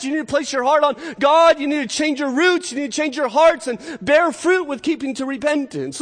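high-pitched, insistent preaching; urgent exhortation. Retold or imitated. John telling people to get ready, urgent exhortation. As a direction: press each demand home as the acted prophet.

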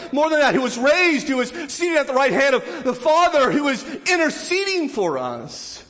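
high, loud, impassioned sermon voice; preaching at full force. Acted. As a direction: drive it home with fervor.

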